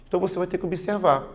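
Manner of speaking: normal talking voice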